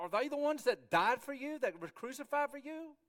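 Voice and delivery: high, loud incredulous question; indignant challenge. Acted. Confronting people who follow human leaders, indignant challenge. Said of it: "and, and these guys".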